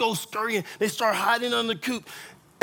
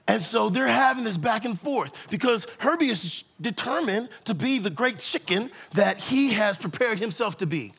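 ordinary talk, nothing marked

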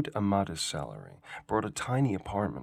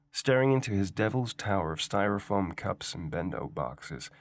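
ordinary delivery

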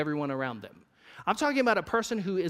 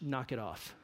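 soft, low teasing scold; mock scolding; 6 semitones lower, 11 dB quieter than usual. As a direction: scold them playfully under your breath. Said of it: "No, just joking".

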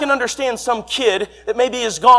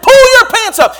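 retold yell; 13 semitones higher, 9 dB louder than usual. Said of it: "I said".

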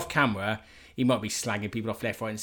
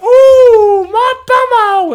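very high, loud, slow dame squeal; panto dame shriek; 17 dB louder, much slower than usual. Retold or imitated. Dad imitating the panto dame's shrill squeal. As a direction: shriek it like Widow Twanky. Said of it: "she's like".

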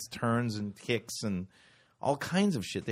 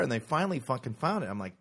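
ordinary talk, nothing marked

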